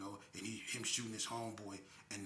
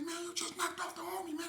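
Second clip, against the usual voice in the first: much higher frantic voice; panicked accusation; 4 dB louder than usual. Retold or imitated.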